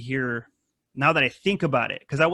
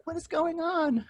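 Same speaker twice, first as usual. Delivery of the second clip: sleepy family voice; 13 semitones higher, 5 dB quieter than usual. Retold or imitated. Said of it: "They're like".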